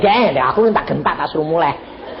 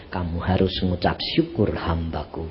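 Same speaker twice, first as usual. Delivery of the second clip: quiet voice of God; 12 semitones lower, 7 dB quieter than usual. Acted. Voicing God answering.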